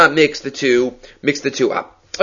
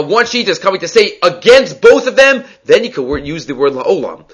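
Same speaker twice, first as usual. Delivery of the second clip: ordinary delivery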